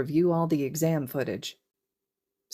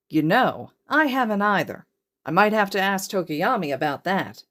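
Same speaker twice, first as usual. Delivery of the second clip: playful reply; 4 semitones higher, 5 dB louder than usual. Acted. Hizashi replies to Eri.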